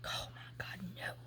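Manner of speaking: whispered, panicked; whispered dread at an approaching rooster; frightened whisper. Retold or imitated. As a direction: breathe it out in quiet dread. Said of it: "And I'm like".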